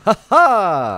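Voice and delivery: loud high victory laugh; triumphant laugh. Real, not acted. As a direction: crow over the find. Triumphant cry.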